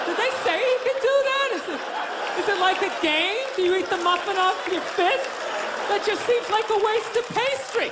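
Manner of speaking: lightly voiced confused onlooker; confused bystander.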